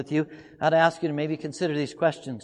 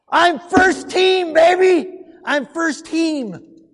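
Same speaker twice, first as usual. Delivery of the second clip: put-on much higher, much louder, slow "I'm first team, baby!"; slow, triumphant shout of "first team"; exultant shout; 10 semitones higher, 8 dB louder, much slower than usual. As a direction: crow it like a proud champion. Exultant boast.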